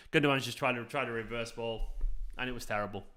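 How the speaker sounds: usual speaking voice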